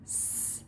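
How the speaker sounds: "S-" a soft voiceless hiss; isolated s sound. Shown as a demonstration. Teacher sounding out sad for a child, hissed "S-". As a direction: breathe out a pure "S-" without a vowel. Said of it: "What's the new word?".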